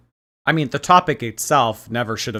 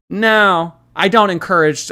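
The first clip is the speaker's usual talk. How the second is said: loud, high, insistent; raised voice, emphatic; emphatic refusal; 7 semitones higher, 7 dB louder than usual. Real, not acted. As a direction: put your foot down loudly. Loud refusal after the outburst.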